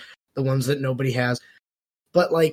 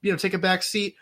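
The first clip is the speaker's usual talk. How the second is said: mock flustered ally; 5 semitones higher than usual. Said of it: "And you go".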